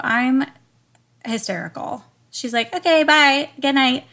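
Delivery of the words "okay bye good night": higher, louder chirpy dismissive farewell; mock breezy goodbye. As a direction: imitate her cheerfully brushing you off. Mocking medium's breezy exit.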